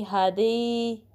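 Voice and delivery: हदी with a pure long ee; slow हदी, no diphthong; wrong ee ending. Shown as a demonstration. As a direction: stretch हदी on ee, skipping the ai glide. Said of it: "ऐसे नहीं पढ़ना".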